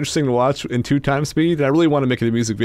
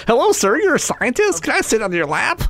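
high-pitched mock boy voice; little boy; 10 semitones higher than usual. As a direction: pipe it up like an eager kid. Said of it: "boys in nineteen fifty-three".

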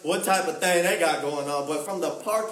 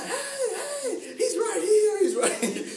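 imitated mom screaming. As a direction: squeal it like an overexcited mom pointing someone out. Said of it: "my mom starts screaming".

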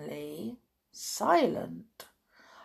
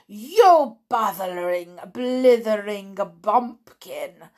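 screeching insult; 7 dB louder than usual. A narrator's reading. Grand High Witch insulting a witch, loud screech. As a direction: spit the insult out. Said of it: "screeched the Grand High Witch".

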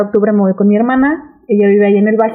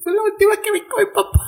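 much higher, softer mock whimper; higher, softer hammed-up sob voice; mock tearful; 9 semitones higher, 6 dB quieter than usual. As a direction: parody her own teary confession. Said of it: "Estaba él de que".

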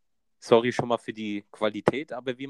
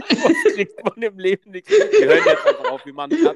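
much higher and louder, cracking up; laughing hard; 11 semitones higher, 11 dB louder than usual. Real, not acted. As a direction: burst out laughing mid-sentence. Cracking up at the failed slogan. (lauthals lachend)

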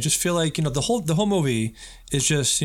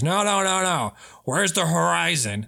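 sharp, higher, insistent correction; impatient director; 6 semitones higher, slower than usual. Retold or imitated. Old director correcting a young filmmaker, insistent. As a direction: drill the kid like a gruff old director. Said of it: "He's like".

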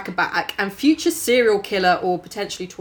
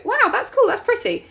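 mock child awe; 11 semitones higher than usual. Children's excited reaction mimicked.

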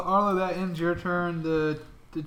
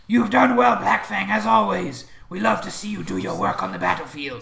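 loud higher hyena-man voice; gnoll voice; 5 semitones higher, 8 dB louder than usual. Acted. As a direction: praise him like a yipping gnoll. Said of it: "that's even worse than the voice".

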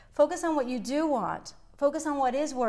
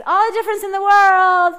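much louder and higher, all-out enthusiasm; excited shout; 7 semitones higher, 12 dB louder than usual. Real, not acted. Host firing up listeners, loud and excited. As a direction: belt it out like a pep rally. Hyped closing to podcast listeners.

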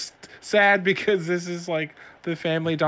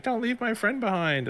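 put-on pleading child; 10 semitones higher than usual. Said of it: "my son is like".

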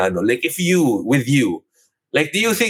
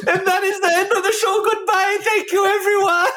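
high squeaky laugh-talk; words squeezed out through laughter. Real, not acted. Fake ending show, laughing.

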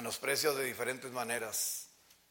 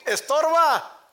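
high, loud, contemptuous blurt of estorba; mocking scorn; 15 dB louder, slower than usual. Preacher imitates children calling their mother a nuisance, loud scorn. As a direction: spit out estorba like a spoiled child. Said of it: "Así dicen unos hijos".

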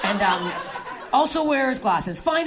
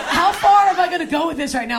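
higher, louder giddy thought; excited; 6 semitones higher, 5 dB louder than usual. Retold or imitated. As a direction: blurt it out giddy. Excited thought during choking.

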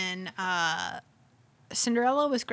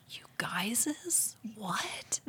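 slow, whispered incredulous question; whispered disbelief; 9 dB quieter, much slower than usual. Retold or imitated. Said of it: "I was like".

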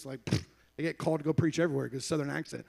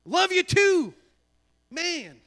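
much higher, playful call-out; mock gushing farewell, pitched way up; exaggerated sign-off; 12 semitones higher, 6 dB louder, much slower than usual. Preacher acting a phone goodbye, high exaggerated call.

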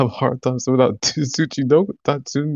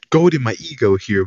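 ordinary talk

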